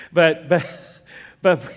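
loud, laughing but-but-but; emphatic restart. Real, not acted. Pastor pulling the crowd back after a joke, loud and laughing. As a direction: burst back in to grab attention.

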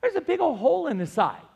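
a hint of a worried girl; daughter's voice. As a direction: daughter pointing out the hole, lightly voiced. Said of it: "she goes".

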